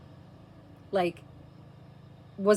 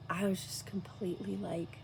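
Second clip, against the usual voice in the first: hushed whisper; 5 dB quieter than usual. Real, not acted. Mother recalling near-death, whispering softly. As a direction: breathe the words as if at peace. Describing abyss of contentment.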